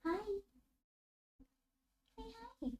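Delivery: very high, soft coaxing; cooing to her pet cat. Real, not acted. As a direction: coax the cat to speak. Talking to the cat.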